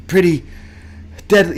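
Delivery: breathless pauses mid-phrase; gasping the words out, mock panting; out of breath. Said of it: "still catching his breath".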